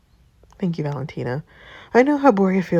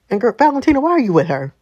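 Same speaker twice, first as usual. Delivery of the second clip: louder and higher, incredulous; loud catty outburst; 6 semitones higher, 7 dB louder, faster than usual. Acted. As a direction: call her out, loud and disbelieving.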